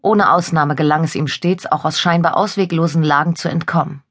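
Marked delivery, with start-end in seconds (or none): none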